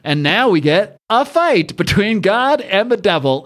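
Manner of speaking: ordinary talk, nothing marked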